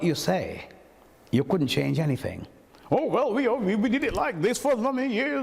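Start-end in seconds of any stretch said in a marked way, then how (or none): 2.9-5.4 mock the stubborn old guard; imitating stubborn old toastmasters; mocking old boys. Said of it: "a bunch of old boys".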